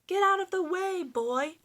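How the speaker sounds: high, loud commanding yell; king shouting orders loud and high; ordered. Acted. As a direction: order him out of the way. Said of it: "ordered the king".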